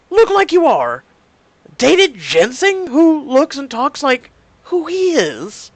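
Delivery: loud, high, indignant yelling; outraged shout. Real, not acted. Player yelling at the GM in outrage. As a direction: shout it out in disbelief.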